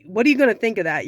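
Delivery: normal talking voice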